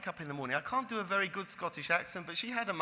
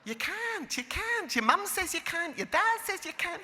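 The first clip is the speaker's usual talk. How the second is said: high, mimicking Scottish squeal; mocking Scottish accent; 12 semitones higher than usual. Teacher turning the student's excuse on her, mock Scottish accent. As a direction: throw her words back in a mock Scottish voice. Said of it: "in her little Scottish accent".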